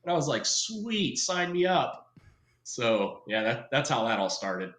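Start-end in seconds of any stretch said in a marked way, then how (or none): 0.4-2.1 high, gung-ho acceptance; mock-eager, voice lifted high; eager agreement. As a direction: imitate a sold kid, pitch jumping up. Retold eager yes to recruiter's pitch.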